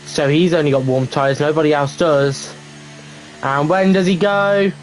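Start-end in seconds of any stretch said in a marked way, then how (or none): none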